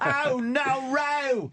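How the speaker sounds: imitating Sal. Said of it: "You sound like Sal".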